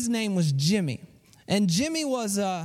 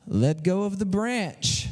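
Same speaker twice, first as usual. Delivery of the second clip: slow, deliberate command from heaven; voice from above; 3 semitones lower, slower than usual. Acted. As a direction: spell out the order slowly and solemnly. Heavenly voice gives the order.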